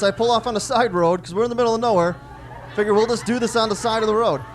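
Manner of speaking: ordinary talk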